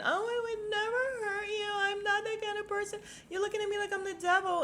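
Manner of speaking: high, whiny mock sobbing; mock crying. Vlogger mocks Sutton's crying grovel, high mock sob. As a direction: imitate her crying and begging. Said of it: "All this crying, and she's basically begging".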